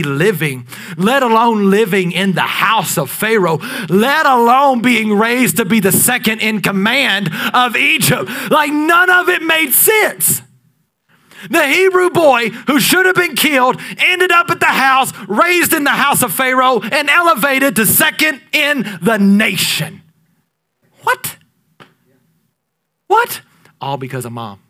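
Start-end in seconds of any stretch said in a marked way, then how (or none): 3.9-20.0 much higher and louder, slow and sung-out; sung-out pulpit climax; preaching climax. Acted. 21.0-23.4 very high squeaked exclamation; astonished high yelp; incredulous what. Acted.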